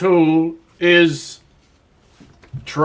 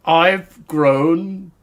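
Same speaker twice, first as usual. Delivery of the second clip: ordinary talk, nothing marked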